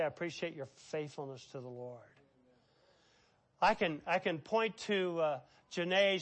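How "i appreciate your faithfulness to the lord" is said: tender praise. Shown as a demonstration. Pastor voicing praise to Chuck.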